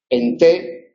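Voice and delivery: usual speaking voice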